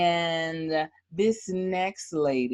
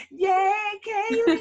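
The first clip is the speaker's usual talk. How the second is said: high excited cheer; 3 dB louder than usual. Real, not acted. Host cheering Kaylee on, high excited. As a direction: cheer her name.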